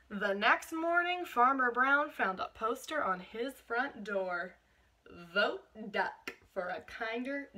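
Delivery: slow, expressive picture-book reading; picture-book narration for a class; storybook reading. Acted. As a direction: draw the children into the story. Story read aloud to class.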